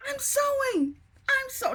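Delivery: high squeal of delight; excited. Real, not acted. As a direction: squeak it out with pride. Proud of her first sewing.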